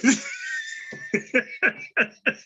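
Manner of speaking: high wheezy laugh in short bursts. Real, not acted. Cracking up at his own milk joke, laughing.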